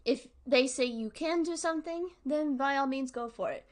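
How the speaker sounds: ordinary talk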